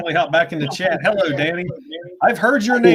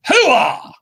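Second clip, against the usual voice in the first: loud high army hooah; loud gung-ho soldier; 12 semitones higher, 4 dB louder than usual. Acted. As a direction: bark it out like a soldier. Signing off with battle cry.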